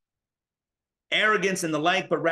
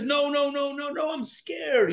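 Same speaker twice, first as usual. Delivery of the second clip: mock scared; 9 semitones higher than usual. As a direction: whimper it like a scared inner child. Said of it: "the inner voice that says".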